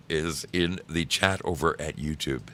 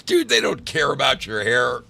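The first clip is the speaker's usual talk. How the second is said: imitating friend's loud reply; 9 semitones higher, 6 dB louder than usual. Friend's retold reassurance.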